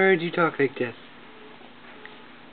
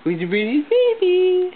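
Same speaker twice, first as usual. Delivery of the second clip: higher, louder sing-song coo; 13 semitones higher, 4 dB louder than usual. Real, not acted. Parent cooing at the baby in high sing-song.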